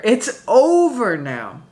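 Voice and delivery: slow and deliberate, leaning on over; It's over now drawn out slowly. Real, not acted. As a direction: stretch each word out, dragging over. Reader declaring the end.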